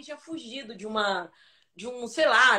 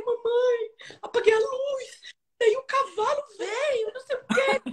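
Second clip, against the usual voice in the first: put-on panicked crying child; 10 semitones higher, slower than usual. Scared child telling her mother about the horse, high tearful wail. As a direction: sob it out to mom in a panic.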